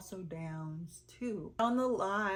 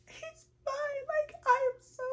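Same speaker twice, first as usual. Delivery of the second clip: much higher tearful crying voice; crying cousin; 6 dB louder than usual. Retold or imitated. Cousin in tears on FaceTime, crying high. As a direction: sob it out, voice breaking high. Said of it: "this is her".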